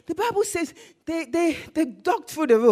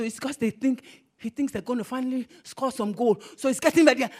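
ordinary talk

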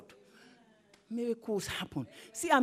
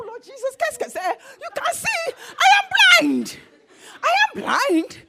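high-pitched, incredulous protest, building louder; exasperated pleading; 12 semitones higher, 8 dB louder than usual. Acted. Blind man answering Jesus's question, exasperated. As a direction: squeal the obvious in comic disbelief.

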